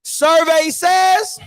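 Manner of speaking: very slow announcer call, says rising up; game show host. Pretend. Vlogger repeating the quiz show reveal, drawn out.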